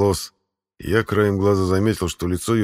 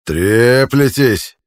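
slow, loud scolding; angry shout; 5 semitones higher, 7 dB louder, much slower than usual. A narrator's reading. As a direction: drag out the rebuke, loud. Centurion scolding the soldiers.

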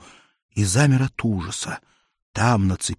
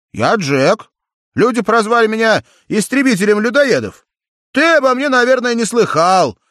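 put-on high, loud boastful young hero; proud hero; 12 semitones higher, 7 dB louder than usual. A narrator's reading. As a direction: announce yourself proudly. Джек представляется попугаю. (гордый голос героя)

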